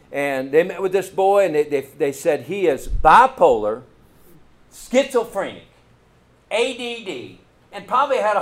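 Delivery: preacher mocking the experts; indignant. Real, not acted. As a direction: lean hard on each label. Indignant retelling of diagnosis.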